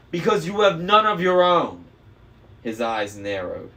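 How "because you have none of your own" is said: loud, much higher scornful jab; scornful retort. A narrator's reading. Annabeth throwing Luke's offer back in scorn. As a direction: cut him down.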